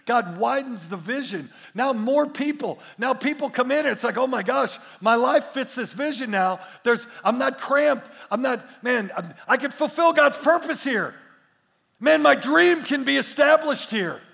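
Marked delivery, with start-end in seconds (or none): 4.2-14.2 excited newcomer. Retold or imitated.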